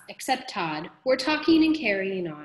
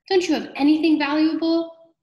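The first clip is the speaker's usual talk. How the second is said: high, louder exasperated plea; 8 semitones higher, 7 dB louder than usual. A narrator's reading. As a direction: press them for loot, voice raised and pitched up. Exasperated robber demands valuables.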